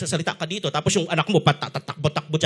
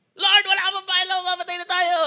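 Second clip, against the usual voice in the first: higher, urgent plea; Peter crying out; 12 semitones higher than usual. Retold or imitated. Peter calling to Jesus over the storm, high and urgent. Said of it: "malamang si Pedro".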